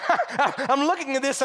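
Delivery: laughing into the words; amused disbelief. Real, not acted. Preacher laughs in disbelief at story.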